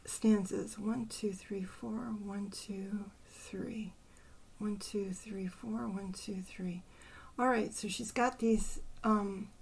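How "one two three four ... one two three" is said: soft, measured count; counting to oneself. Real, not acted. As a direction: count the syllables off quietly, half to yourself. Counting syllables to herself.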